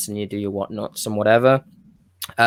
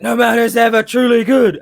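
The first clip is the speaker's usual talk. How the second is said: higher, louder, portentous villain line; ominous wrestler promo; 10 semitones higher, 6 dB louder than usual. Retold or imitated. Wrestler cutting a dark promo after the creaking ring steps, higher and louder. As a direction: deliver it like a brooding heel on the mic. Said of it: "And it's like".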